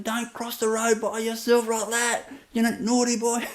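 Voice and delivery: a hint of a stranger scolding a child; scolding lady. Lady on the street scolding a boy, a hint of mock scolding. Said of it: "like this".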